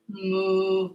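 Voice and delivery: low soft cow moo. Pretend. Kids yoga cat cow sounds.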